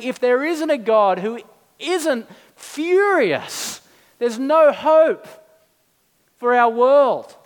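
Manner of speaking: loud, high and slow emphasis; forceful preaching climax. Real, not acted. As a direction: drive it home to the congregation. Preacher drives home the point.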